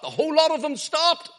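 loud, high-pitched excited storytelling; impassioned pulpit storytelling at high pitch; dramatic preaching. Real, not acted. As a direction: raise the voice to act out a startled crowd. Preacher dramatises the startled crowd.

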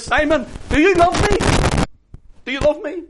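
very high, loud urgent question; impassioned shout. Retold or imitated. Jesus asking Simon for his love, impassioned shout. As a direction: call it out with full preaching force.

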